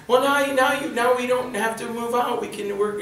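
high-pitched wife voice; mock hopeful wife. Wife thinking they can stay, high mock hopefulness. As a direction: play the wife, pitched well up. Wife pleading in retold scene.

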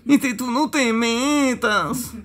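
slow, high comic character voice; high drawled warning to stay out; Afelandro imitation. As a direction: stretch it out in a high put-on voice. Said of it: "ya va usted imitando la voz de Afelandro".

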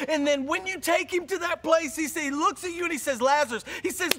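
loud, high, driving preaching; preaching at full force. Acted. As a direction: hammer it home to the congregation.

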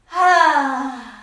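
long, audible exhale; demonstrative yoga sigh; big breath out. Shown as a demonstration. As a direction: breathe out fully with a loud sigh. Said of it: "Big breath out".

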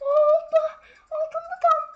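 half scared, half laughing; shrieking laugh. Real, not acted. Gamer seeing snakes below, shrieking with laughter.

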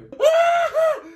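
loud squeal of a laugh; high shrieking laugh. Real, not acted. Watching the powder froth, he lets out a shrieking laugh. Frothing mixture surprises him.

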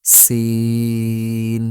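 সিন with a thin s and very long level vowel; long-drawn সিন. Shown as a demonstration. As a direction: stretch the i of সিন on one note. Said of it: "তিন আলিফ পরিমাণ টান". (সিন লম্বা টেনে)

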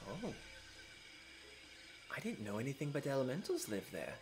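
lightly voiced noble girl, gentle and curious; Emma's voice. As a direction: speak as a poised half-elf princess. GM voices Emma.

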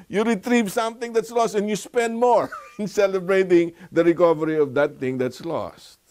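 excited. Real, not acted. Preacher mocks lavish celebration.

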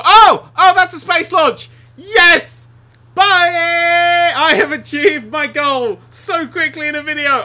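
loud, high shouts of glee; elated yelling, voice pitched right up; triumphant excitement. Real, not acted. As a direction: crow over an instant victory. Bus launches into space, goal achieved.

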